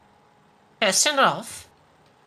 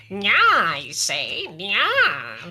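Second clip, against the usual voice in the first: mustache twirling villain; 3 semitones higher, 4 dB louder, much slower than usual. Pretend. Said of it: "Watch me twirl my mustache".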